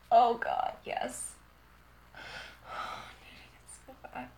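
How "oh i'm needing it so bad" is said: very soft, unvoiced plea; hushed and yearning; whispered longing. Real, not acted. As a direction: whisper the need. Intimate, hushed repeat.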